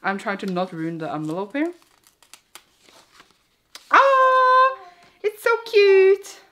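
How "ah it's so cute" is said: high delighted squeal. Real, not acted. Squealing at a cute card from friends. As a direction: squeal over something cute.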